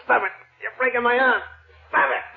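high pained yelp, arm twisted; arm-lock pain; pained cry. Acted.

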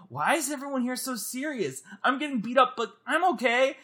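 high, light cartoonish voice; Boot walking in. Boot wandering into the serious movie, high innocent voice. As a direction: say it high, like a carefree sidekick. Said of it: "he will randomly walk in and go like".

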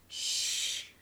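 unvoiced hush; shushing. A narrator's reading. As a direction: hush everyone quietly. Dollies hush her.

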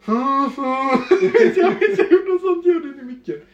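put-on high, slow, laughing drunken mumble; mock drunk mumbling into the mic, laughing; laughing drunk slur. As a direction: slur it out while cracking up.

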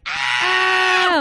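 long, high, loud scream; imitated crowd scream. Crowd hears the song come on, imitated excited scream.

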